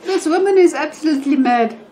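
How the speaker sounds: giggling helplessly while talking; laughing. Real, not acted. A vlogger laughing as she tells viewers the story.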